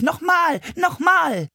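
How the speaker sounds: put-on high gleeful toddler shout; excited shout. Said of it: "kippte er vor Lachen um und rief". (hoher, jubelnder Kinderruf)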